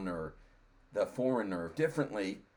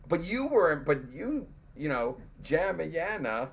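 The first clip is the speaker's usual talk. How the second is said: protesting; 7 semitones higher, 4 dB louder than usual. Retold or imitated. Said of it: "I was like".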